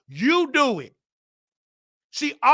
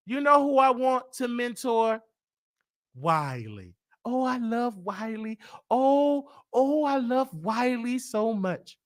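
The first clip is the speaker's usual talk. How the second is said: soft, slow, syrupy put-on coo; mock sweet Tasha; 5 dB quieter, slower than usual. Mocking Tasha's fake love for him, soft syrupy coo.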